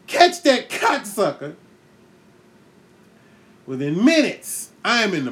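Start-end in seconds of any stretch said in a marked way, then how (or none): 0.1-1.5 slow, raised quote of the line; mock movie shout. Eight-year-old blurting the movie line, slow mock shout. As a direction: yell it out like the movie. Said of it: "So I say".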